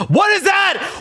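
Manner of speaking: very high, loud what is that; shocked cry. Real, not acted. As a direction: recoil at the sight in high alarm. Porn appears under his tweet.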